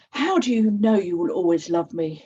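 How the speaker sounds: loud, hurt shout across the gap. A narrator's reading. Millie shouting across the ravine at her mother, loud and hurt. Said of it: "she shouts to her mother".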